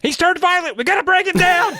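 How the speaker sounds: high, hammed-up cop yell; mock urgent order; officer raising alarm. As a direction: shout it like a panicked cop. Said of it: "the officer goes".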